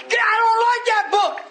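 very high wailing demon cry; panicked devil. Retold or imitated. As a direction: shriek in protest, pushing the book away. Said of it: "you should have seen that one".

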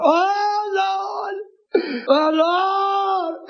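high, drawn-out mock wail; imitating a holler. Preacher mimics woman interrupting his sermon, high hollering. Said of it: "she'd, uh, She hollered".

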